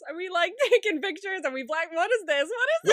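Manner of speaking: rising incredulous laughing outburst. Real, not acted.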